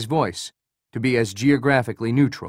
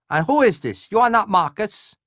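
higher, nasal, suspicious colonel; a wary officer, pitched up and nasal; nasal Korean colonel; 9 semitones higher, 3 dB louder than usual. Acted. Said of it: "said a man with a high nasal voice".

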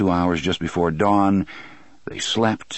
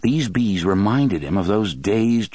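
ordinary delivery

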